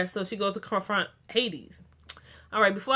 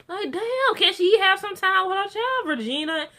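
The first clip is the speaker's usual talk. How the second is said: high-pitched, exasperated protest; exasperated plea; 10 semitones higher, 4 dB louder than usual. Real, not acted. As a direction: plead with pitch way up, easing down on the name. Exasperated plea to a show character.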